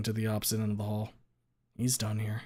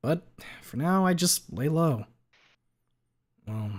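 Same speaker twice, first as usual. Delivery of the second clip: ordinary talk, nothing marked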